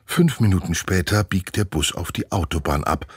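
ordinary delivery, nothing marked

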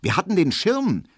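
high-pitched, surprised query; astonished question. A narrator's reading. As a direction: ask it in astonishment, voice jumping up. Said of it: "fragt Frau Braunack erstaunt".

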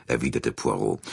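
plain reading voice

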